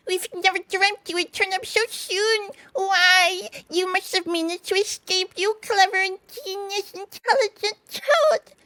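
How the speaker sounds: high, gushing praise in a character voice; gushing rat. Acted. Rat buttering up Toad, high gushing voice. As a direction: lay the flattery on thick, high up.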